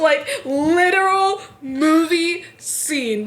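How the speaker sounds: high, slow words broken by real laughter; giggling, almost sing-song; laughing. Real, not acted. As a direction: laugh your way through it, drawn out. Recalling a chaotic train scene.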